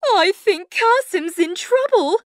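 high, loud, distressed wife; tearful wife in a very high voice; wailing. A narrator's reading. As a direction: wail your worry, pitched right up. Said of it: "she wailed".

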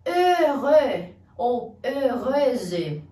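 "Heureux ou heureuse" drawn out slowly; "heureux" and "heureuse" with rounded eu; slow, careful model of "heureuse"; slow model words. Shown as a demonstration. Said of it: "exemplos de palavras com essa vogal".

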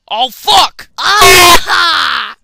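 real yell of frustration at dying; pained shout. Real, not acted. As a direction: cry out, stung and furious. Dies in game, real outburst.

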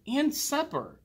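usual speaking voice

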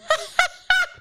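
high-pitched cackling laugh; demonstrative laugh. Shown as a demonstration. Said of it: "See, that was a laugh".

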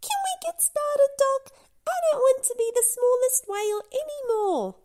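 very high, pleading little voice; tiny whale voice. Acted. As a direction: beg the doctor, high and small. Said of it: "Lula pleads".

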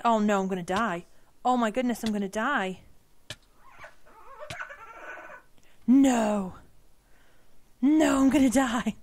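loud mock panic over dying; hammed-up panic. Real, not acted. Player poisoned in a game, loud mock panic. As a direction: wail it in playful dramatic panic.

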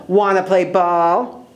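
slow, high, rising challenge; shouted taunt. Acted. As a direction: call it out as a drawn-out dare. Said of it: "shouted Belinda".

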